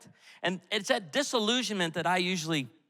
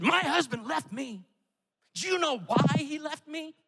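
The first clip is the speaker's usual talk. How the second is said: mimicking angry woman; 9 semitones higher than usual.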